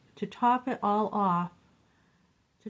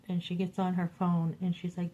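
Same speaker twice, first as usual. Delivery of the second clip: ordinary talk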